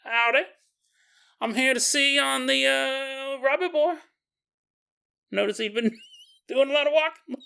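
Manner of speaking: high-pitched, hammed-up country sheriff accent; sheriff's accent. Sheriff visiting the coroner, comically high country accent. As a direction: greet folks in a silly high rural twang accent. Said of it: "The sheriff walks in".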